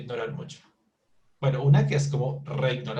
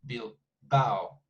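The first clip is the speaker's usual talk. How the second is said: Bilbao split slowly into Bil and bao; two syllables; 2 semitones lower than usual. Shown as a demonstration. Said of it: "it's two syllables".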